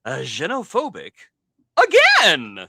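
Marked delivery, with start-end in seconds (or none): 1.8-2.7 high, loud burst on one word; exclaimed punchline. Pretend. Reader hitting the synopsis punchline, loud and high. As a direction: punch it out as a shout.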